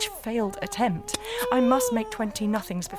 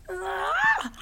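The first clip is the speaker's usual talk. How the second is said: frustrated cry; 11 semitones higher than usual. Acted. Frustrated cry over poppers.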